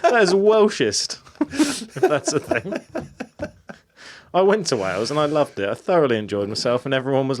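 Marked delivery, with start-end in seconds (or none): 0.0-2.7 laughing through the words, slowly. Real, not acted. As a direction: giggle as you protest. Laughing while joking about racism.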